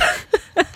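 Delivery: high nervous giggle; quick giggle at herself. Real, not acted. Laughs at own fear.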